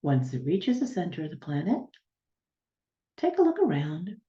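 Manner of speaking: unhurried guiding voice, long silences between instructions; guided meditation. Real, not acted. Healer guiding listeners to ground down to the planet's center, guided meditation. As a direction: lead them step by step, letting each image settle.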